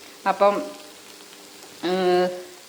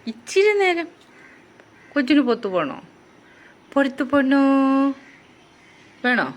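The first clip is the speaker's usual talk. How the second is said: much higher and louder, sing-song coaxing; 5 semitones higher, 5 dB louder than usual. Real, not acted. Owner asking her pet if it wants to go out, sing-song high. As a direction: tease the pet in a lilting high voice.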